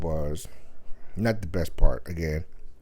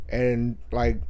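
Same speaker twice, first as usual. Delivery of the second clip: ordinary talk, nothing marked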